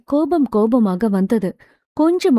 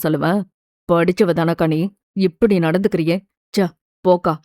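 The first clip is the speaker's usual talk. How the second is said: low-pitched man's voice, mildly scolding; understated angry rebuke; 5 semitones lower than usual. A narrator's reading. Brother rebuking his sister for blaming the child, restrained anger. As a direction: reprove sternly in a lower register, without raising the volume. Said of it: "என கத்திவிட்டு".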